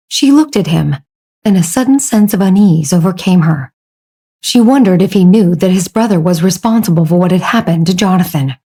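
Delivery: usual speaking voice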